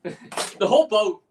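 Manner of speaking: real laughter about the whole boat; laughing. Real, not acted. Laughing about the great night.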